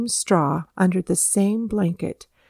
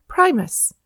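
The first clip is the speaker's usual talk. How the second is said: higher, more insistent call of a name; firmer repeated call; 6 semitones higher than usual. A narrator's reading. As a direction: call to wake a sleeper. Calls again to wake him.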